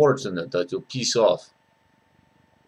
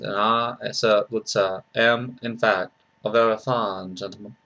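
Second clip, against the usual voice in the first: soft, genteel New Orleans accent; New Orleans gentleman; 5 dB quieter than usual. Shown as a demonstration. Soft gentleman from New Orleans inviting friends along, gentle Southern accent. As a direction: speak softly as a courtly New Orleans Southerner. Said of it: "a soft gentleman".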